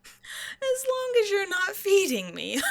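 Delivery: high, loud, drawn-out mock plea; slow, high and loud, exaggerated; mocking retort. Mimics captive's answer, mocking.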